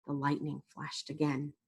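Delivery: ordinary delivery, nothing marked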